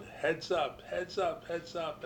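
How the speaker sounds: mild mock yell of warning. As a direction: call out heads up to the players across the field.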